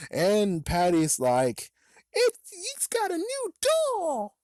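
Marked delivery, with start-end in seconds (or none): 2.1-4.4 put-on squeaky girl voice. Patty voiced in a retold story.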